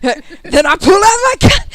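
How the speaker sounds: high, loud hammed-up shout; mock shout. GM joking about the shopkeeper's gun, hammed-up loud shout. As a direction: ham up a dramatic reveal.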